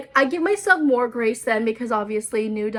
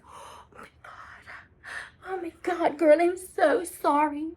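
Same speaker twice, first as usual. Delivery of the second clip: mock sympathy; 5 semitones higher, 4 dB quieter, slower than usual. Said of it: "They're like".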